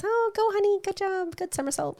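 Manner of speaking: high, sing-song cheering mom from the bleachers; cheering gym mom. Retold or imitated. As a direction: gush up high like a doting mom. Mocking cheering gym moms.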